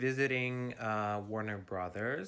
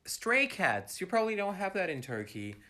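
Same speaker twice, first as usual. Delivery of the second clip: higher, mocking imitation of a condescending teacher; 7 semitones higher, 3 dB louder than usual. Student mimics teacher's condescending refrain, higher and mocking. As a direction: sneer it like the know-it-all teacher. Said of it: "he'd always say".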